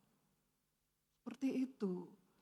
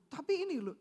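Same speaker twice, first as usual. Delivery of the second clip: usual speaking voice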